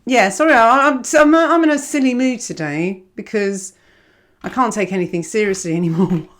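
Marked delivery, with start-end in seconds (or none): none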